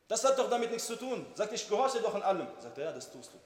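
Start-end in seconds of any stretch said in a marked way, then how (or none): 0.1-2.5 higher-pitched indignant retort; annoyed. Retold or imitated. Wife defending her obedience to her husband, annoyed. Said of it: "sagt, ich bin sauer geworden". 2.8-3.4 soft, lower, gentle reply; loving. Retold or imitated. Husband gently correcting his offended wife, loving. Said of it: "mit Liebe hat er gesagt".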